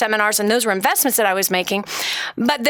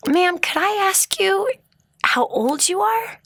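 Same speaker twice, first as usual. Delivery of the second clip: gentle polite asking; 10 semitones higher, slower than usual. Retold or imitated. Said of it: "I said".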